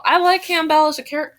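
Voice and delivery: usual speaking voice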